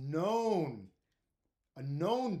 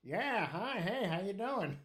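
higher, sing-song preening greeting; mocked guy's voice; 4 semitones higher, slower than usual. Prankster replaying how his buddy greeted girls, mock smooth show-off. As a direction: put on his pleased-with-himself hello. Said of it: "That's how we made him talk".

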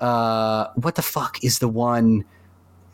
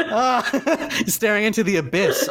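laughing; 9 semitones higher than usual. Pretend. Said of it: "that was scary".

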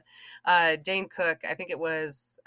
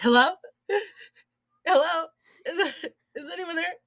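panicked mock call; 11 semitones higher, 5 dB louder than usual. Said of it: "you're like".

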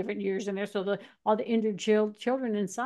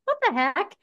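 higher-pitched retold bafflement; mock-puzzled, pitched up; baffled; 8 semitones higher, 3 dB louder than usual. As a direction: recall your baffled first reaction. Reaction at first hearing.

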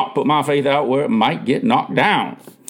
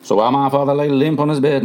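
ordinary delivery

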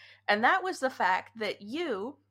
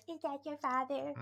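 higher, softer quoted voice; imitated supervisor question; 5 semitones higher, 6 dB quieter than usual. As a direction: imitate her question, pitched up and hushed. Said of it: "she goes".

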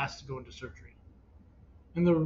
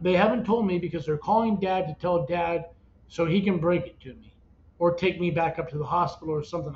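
normal talking voice

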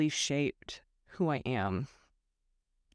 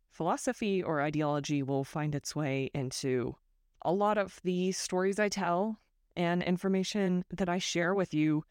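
normal talking voice

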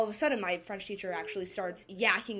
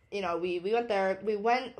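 ordinary talk

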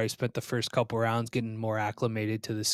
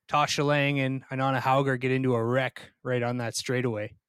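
normal talking voice

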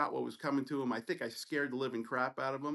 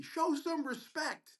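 mock scream; 12 semitones higher, slower than usual. Other resident yelling down the hallway at the student, mock scream. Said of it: "screams down the hallway".

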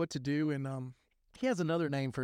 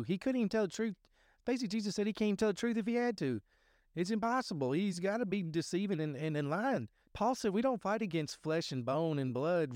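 higher-pitched preacher fervor, driving; rising into preaching; 4 semitones higher than usual. Acted. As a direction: preach it up like Sunday, pitch climbing. Preaching about the devil.

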